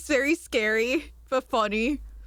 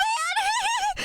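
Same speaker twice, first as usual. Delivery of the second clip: sharp squealing laugh, much higher. Real, not acted. Laughing at her own man joke, high squeal.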